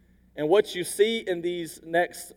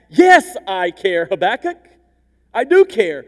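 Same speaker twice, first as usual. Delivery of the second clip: emphatic all caps; 9 dB louder than usual. Retold or imitated. God answering Habakkuk's doubt, loud emphatic reassurance. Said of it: "God's answer is emphatic, and it's with all caps".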